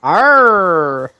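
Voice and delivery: big loud mock pirate "Arr". Playing pirate for a co-player, loud drawn-out growl. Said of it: "Say shard in a pirate voice".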